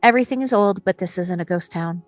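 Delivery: ordinary talk, nothing marked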